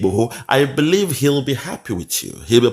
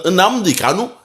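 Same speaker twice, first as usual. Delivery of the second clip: put-on higher, lilting take-off of a Yoruba accent; mock Yoruba accent; 7 semitones higher than usual. Commentator showing the opponent his own accent, mock Yoruba accent. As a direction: say Nnamdi Kanu high in a mimicked Yoruba accent, rising at the end. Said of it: "That's your accent".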